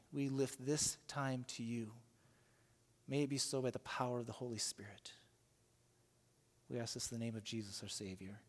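soft, hushed prayer voice. Real, not acted. Pastor praying over the congregation, soft and reverent. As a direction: pray quietly and reverently before the reading. Opening prayer before the sermon.

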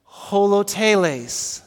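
holoteles slow and clear, ho-lo-te-les; teaching pronunciation. Shown as a demonstration. Pastor leading congregation to repeat the word, slow. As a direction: say holoteles slowly, syllable by syllable. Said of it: "you ready?".

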